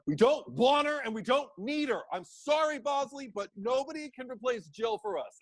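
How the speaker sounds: high, mock-indignant protest; indignant angels. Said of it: "they're just saying things like".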